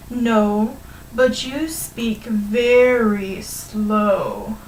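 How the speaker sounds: exaggeratedly drawn-out, teasing slowness; very slow mockery. Hare teasing the tortoise, very slowly. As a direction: drag each word out mockingly. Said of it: "said the hare very slowly".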